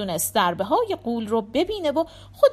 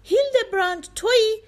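high, loud cry of relief from a pit; joyful shout; 11 semitones higher, 5 dB louder than usual. A narrator's reading. Trapped Dietrich hears his old master fighting and shouts joyfully. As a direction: call out overjoyed to a friend you thought lost. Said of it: "با شادی فریاد کشید".

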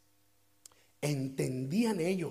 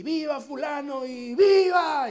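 crowd cheering; 8 semitones higher, 8 dB louder than usual. Acted.

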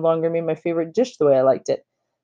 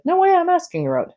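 much higher, quick, bursting claim; eager claim; 13 semitones higher than usual. Retold or imitated. Boy cutting off his friend to claim Summer, eager and high. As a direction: jump in before your friend can.

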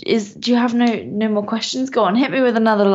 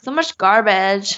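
slow put-on American "garbage"; American accent. British guest proud of her impression, American accent. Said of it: "such a good American accent".

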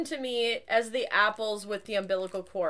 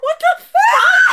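incredulous laughing shout; 12 dB louder, slower than usual. Real, not acted. Reacting to teasing nickname.

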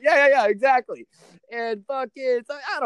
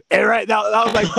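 ordinary talk, nothing marked

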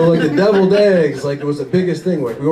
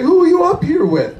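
higher, sharp accusing wife; 12 semitones higher, 4 dB louder than usual. Retold or imitated. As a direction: demand an answer from the cheating husband. Wife confronting husband.

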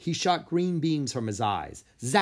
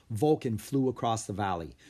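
usual speaking voice

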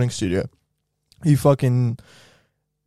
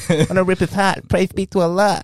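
Mike Tyson impression; 7 semitones higher than usual.

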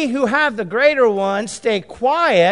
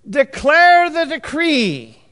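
slow, loud and high, declaring it; proclaimed decree; 8 semitones higher, 6 dB louder, much slower than usual. Shown as a demonstration. Corrected reading, proclaimed.

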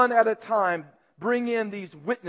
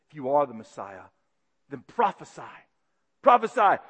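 mocking taunt; 6 semitones lower than usual. Guards mocking Jesus, sneering taunt. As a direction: taunt the blindfolded prisoner. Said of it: "They mocked him".

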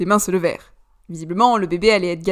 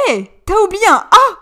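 higher and louder, jumping in eagerly; eager correction; 11 semitones higher, 12 dB louder than usual. A narrator's reading. Alistair correcting his friend, higher and louder. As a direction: cut in with a bright correction. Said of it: "releva Alistair".

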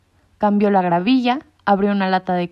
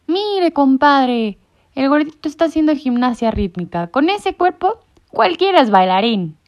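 mocking alley cat; 6 semitones higher, 5 dB louder than usual. A narrator's reading. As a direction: sneer at the fat one across the yard. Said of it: "maulló uno". (gato callejero burlón)

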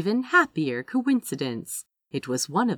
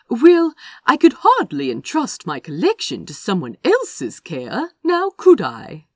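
louder and higher, sweetly reasonable; utmost reason; 5 semitones higher, 8 dB louder than usual. Acted. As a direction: make it sound perfectly obvious. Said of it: "in a tone of utmost reason".

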